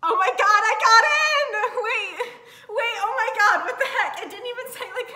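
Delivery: real overjoyed shriek; ecstatic scream. Real, not acted. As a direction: scream with disbelief, laughing and near tears. Got into Georgetown.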